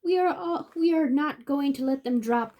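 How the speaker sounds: bright, high storybook reading voice; reading to children. Acted. Reading the Dr. Seuss book aloud, high and lively.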